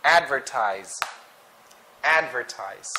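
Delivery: "Advertise" with stress on AD; stress on first syllable. Shown as a demonstration. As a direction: hit AD first in "Advertise", then fall. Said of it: "the stress is in the first syllable".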